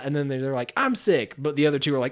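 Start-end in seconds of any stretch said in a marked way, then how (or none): 0.8-1.4 high, falling mock complaint; hammed-up cover story; acted blurted excuse. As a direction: blurt out a made-up illness.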